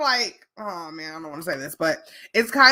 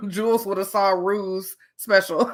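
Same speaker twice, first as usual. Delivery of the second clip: normal talking voice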